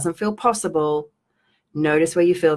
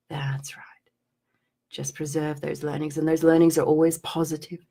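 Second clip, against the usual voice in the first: trancey guidance; 4 dB quieter than usual. Acted. Coach guiding live viewers through a release, soft trance voice. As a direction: lull them into noticing the feeling. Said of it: "weird trancey stuff".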